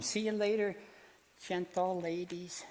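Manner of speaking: puppet charming the grandmas; ventriloquist's puppet bidding farewell; high puppet goodbye. Acted. Puppet says goodbye to ladies.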